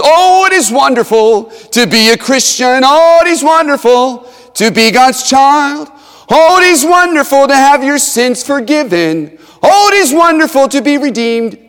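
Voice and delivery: loud, high, fervent preaching; preaching at full force. Acted. Preacher rousing the congregation about forgiveness, loud fervent preaching. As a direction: drive every line home like a revival preacher.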